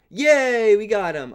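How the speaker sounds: loud happy yay; excited cheer. Real, not acted. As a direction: cheer out the win. Cheering after a kill.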